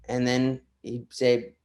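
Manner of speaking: normal talking voice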